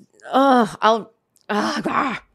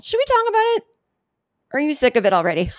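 lightly acted question, pitched higher; retold own question; 6 semitones higher, much faster than usual. Podcaster replaying asking her cohost about covid, higher. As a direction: replay your earlier self, eager. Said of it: "I remember asking people".

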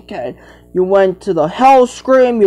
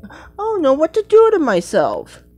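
high, squeaky pretend character voice; squeaky toy voice; 9 semitones higher than usual. A child voicing a toy character, high and squeaky. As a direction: pipe it up like a little cartoon animal.

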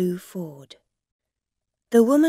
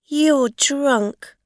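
accusing; 7 semitones higher, 8 dB louder than usual. Acted. Rosa accuses.

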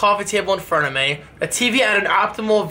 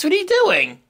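high dog voice; 11 semitones higher than usual. Real, not acted. Talking to the dog, pitch jump.

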